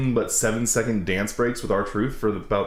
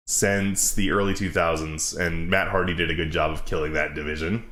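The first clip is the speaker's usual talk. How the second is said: normal talking voice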